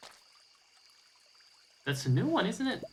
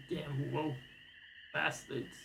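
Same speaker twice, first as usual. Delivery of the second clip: mock bigot mutter; 10 dB quieter, slower than usual. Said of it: "his grandson is like".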